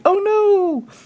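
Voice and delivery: very high, tiny squeal of alarm; squeaky creature panic. Pretend. The player's creatures crying out as the worm attacks, very high squeal. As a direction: shriek it like a little panicked creature. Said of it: "All my creatures can say".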